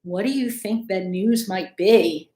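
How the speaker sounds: ordinary delivery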